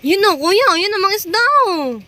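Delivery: eager calling out; excited. Real, not acted. Spotting the fish.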